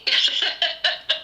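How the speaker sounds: short airy giggle; light unvoiced chuckle; breathy laugh. Real, not acted. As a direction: laugh at the memory. Laughs recalling Samwise Gamgee.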